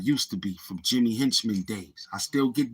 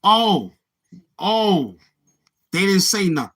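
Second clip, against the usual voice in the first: surprised; 7 semitones higher, 5 dB louder than usual. Real, not acted. Reacting to chat.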